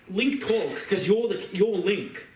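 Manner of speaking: normal talking voice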